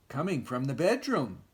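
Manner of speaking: plain reading voice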